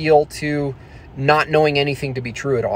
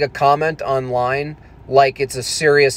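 normal talking voice

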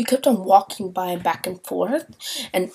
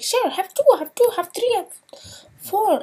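high, louder mocking voice of the bread guy; 12 semitones higher, 4 dB louder than usual. Bread guy piling on bread, high mock voice. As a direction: ham up the overly generous server. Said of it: "He's like".

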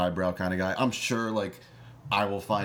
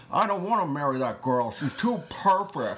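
put-on higher, drawn-out old movie star voice; imitating Jimmy Stewart; 6 semitones higher than usual. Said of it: "does this whole thing where he's like".

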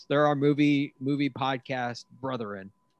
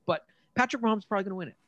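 rushed; 8 semitones higher, much faster than usual. Real, not acted. Rushed aside.

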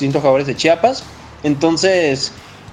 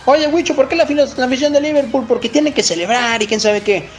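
high mock listener voice; 8 semitones higher than usual. Voices a fan asking him.

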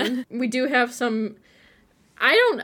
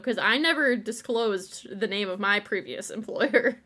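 usual speaking voice

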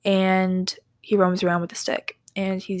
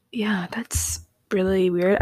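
whisper; slower than usual. Real, not acted.

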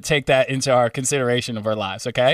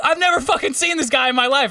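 indignant denial; 11 semitones higher than usual. Retold or imitated. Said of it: "And they're like".

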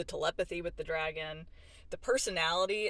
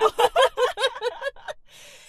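high burst of laughter; loud laugh; 13 dB louder than usual. Real, not acted. Reacting to a spoiler.

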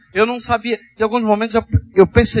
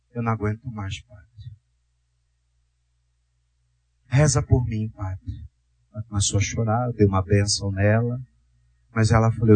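very low, gentle storytelling voice; hushed testimony; 11 semitones lower, 4 dB quieter than usual. Retold or imitated. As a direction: recount it tenderly, deep and subdued. Hushed telling of encounter.

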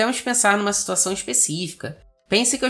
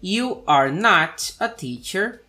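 very slow, clear English drill; slow model sentences; much slower than usual. Shown as a demonstration. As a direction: spell it out slowly for students. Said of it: "eu teria". (frases lentas e claras, como modelo)

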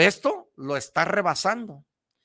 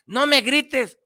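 higher, lightly voiced hurt child; offended child; 9 semitones higher, 3 dB louder, slower than usual. Offended son answering parent who yelled, hurt protest. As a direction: let the kid push back, wounded. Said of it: "el niño se siente ofendido ... y te dice".

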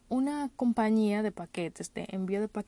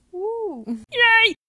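sudden high, loud squeal of joy; excited cheer; 12 semitones higher, 11 dB louder than usual. Real, not acted. Host celebrating the new episode, squealing cheer. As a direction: cheer like the party just started.

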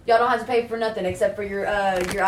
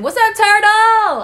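much higher, louder, drawn-out call; loud mock greeting; 12 semitones higher, 10 dB louder, slower than usual. Server calling out to slow coworker, loud and high. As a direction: holler across the room at him.

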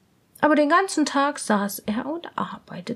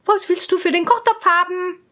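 higher, prim court lady; lady-in-waiting; 6 semitones higher, 4 dB louder than usual. Acted. Lady-in-waiting asks the swineherd, higher and prim. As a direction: ask the price primly, pitched up. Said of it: "fragte die Hofdame".

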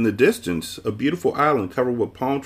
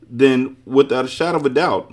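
bright, higher and louder deduction; 6 semitones higher, 6 dB louder than usual. A narrator's reading. Milo solves who the man is, brightly. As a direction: conclude brightly, voice lifted. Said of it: "Milo concluded brightly".

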